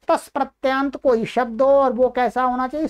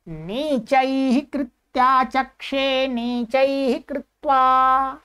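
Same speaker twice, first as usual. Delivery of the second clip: slow dictation; much slower than usual. Shown as a demonstration. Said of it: "teacher dictating Sanskrit example".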